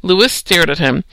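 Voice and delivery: usual speaking voice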